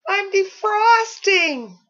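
high, loud, slow anguished cry. A narrator's reading. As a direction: cry it out in despair. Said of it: "cried a snowman".

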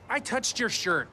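high pitched defense; protest, voice cracking up; exasperated. Acted.

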